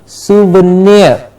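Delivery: souvenir slowly as "soo-vuh-NEER"; careful pronunciation. Shown as a demonstration. Teacher asking learners to remember it, slow careful souvenir. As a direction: draw out each syllable of souvenir. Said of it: "Please remember the pronunciation".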